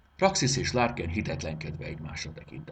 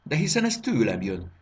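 higher, louder cry of surprise; surprised exclaim; 6 semitones higher, 6 dB louder than usual. A narrator's reading. The boy realises the voice is from his pocket, surprised cry. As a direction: realise it is coming from you. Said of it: "kiáltott fel meglepetten".

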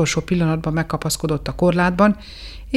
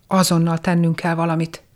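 ordinary delivery, nothing marked

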